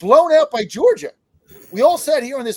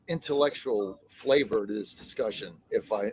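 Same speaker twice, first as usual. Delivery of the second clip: lower, softer mock-serious pitch; trying to keep a straight face; mock-intellectual; 10 semitones lower, 7 dB quieter than usual. Co-host mock-challenging the panel, faux highbrow. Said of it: "I can't even say that with a straight face".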